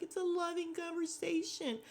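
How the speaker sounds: high-pitched mock-sincere imitation; phony sweetness. Mocking Jasmine as she smooths things over, phony sweetness. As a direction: put on a fake, sugary high voice. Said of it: "with her phony ass".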